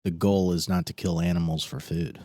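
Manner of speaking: low, soft earnest vegan; a lightly voiced earnest vegan; calm vegan voice. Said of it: "here's how the educated vegan responds".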